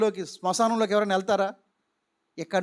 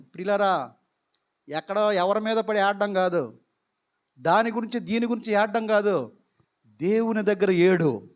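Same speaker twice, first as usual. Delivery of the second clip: usual speaking voice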